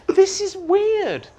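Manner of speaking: mock-bewildered high whine; baffled squeak. Retold own baffled reaction.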